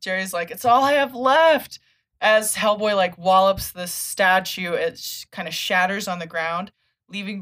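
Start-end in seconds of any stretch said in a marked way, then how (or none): 0.5-1.8 lament. Retold or imitated. Jerry laments.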